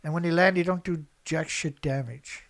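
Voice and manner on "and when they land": ordinary talk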